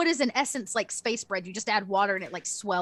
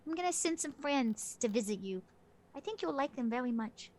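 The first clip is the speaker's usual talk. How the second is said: softly spoken, understated menace; veiled threat; 7 dB quieter than usual. Acted. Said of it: "Was that a threat?".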